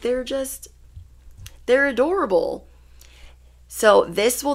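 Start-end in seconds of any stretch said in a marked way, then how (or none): 0.0-2.7 higher, cooing over something adorable; cutesy gushing. Real, not acted. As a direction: gush like it's a puppy. Gushing over jackalope drawings.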